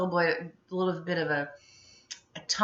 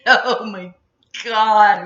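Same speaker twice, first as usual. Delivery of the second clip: laughing; 4 semitones higher, 13 dB louder, much slower than usual. Real, not acted. Laughing about getting in trouble.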